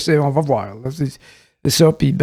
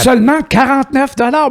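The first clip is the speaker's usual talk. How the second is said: infomercial; 6 semitones higher than usual. Pretend. Joins the ad parody.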